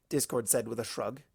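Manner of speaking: usual speaking voice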